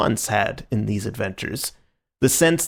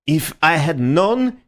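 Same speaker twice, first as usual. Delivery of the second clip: spluttering; 6 semitones higher, 4 dB louder, slower than usual. Acted. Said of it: "he spluttered".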